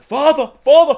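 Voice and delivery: high, loud call for a parent; mock calling out. Son calling to his father in the story, mock cry.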